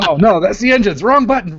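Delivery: high, playful mock alarm; mock panic. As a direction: squeal it like a panicked pilot. Joking panic in pilot bit.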